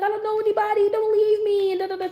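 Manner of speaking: high, pleading mock wail; imitated crying child. Inmate's daughter dropped at summer camp, imitated crying plea. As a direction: beg in a put-on child's whine. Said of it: "she was crying and screaming for her mom".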